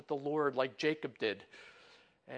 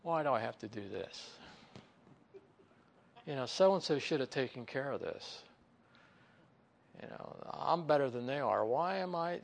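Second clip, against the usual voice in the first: grumbling complainer; 3 semitones lower than usual. Pretend. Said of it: "and, and we mumble".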